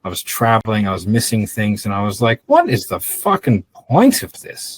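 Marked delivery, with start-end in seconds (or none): 2.5-4.8 exasperated outburst. Retold or imitated. Fed up with old business.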